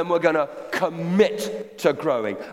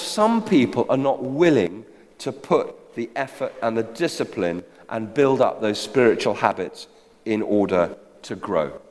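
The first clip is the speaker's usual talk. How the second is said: ordinary talk, nothing marked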